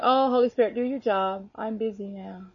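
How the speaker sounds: mock brush-off, fading to very soft; dismissive. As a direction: imitate someone too busy, trailing away. Mimics a distant, dismissive Father.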